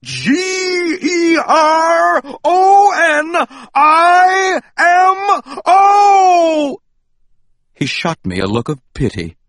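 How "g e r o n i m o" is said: slow, high, loud spelling with a cry; furious spelled-out shout of his own name. Acted. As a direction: shout each letter of Geronimo apart. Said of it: "spelled out letter by letter".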